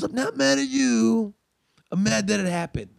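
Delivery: slow pained voice; slow hammed-up frustration; slow anguished. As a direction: force it out slowly through frustration. Host punching wall, explaining.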